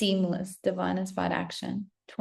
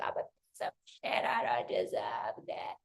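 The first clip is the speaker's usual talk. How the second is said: self-loathing mutter; 10 semitones lower, 7 dB quieter than usual. Retold or imitated. Voicing a viewer who feels undeserving, mutter. Acting out an unworthy inner voice.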